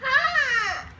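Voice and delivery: shouting hot; yelping; loud complaint. Real, not acted. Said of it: "she says hot".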